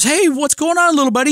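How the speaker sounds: friendly celebrity greeting. Retold or imitated. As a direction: greet the kid brightly and high. Travis voiced greeting son.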